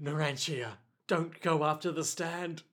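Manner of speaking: soft, fading plea; weak dying plea. Acted. Wounded Giorno pleads softly with Narantia. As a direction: call out weakly as he vanishes. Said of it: "Giorno reaches out and is like".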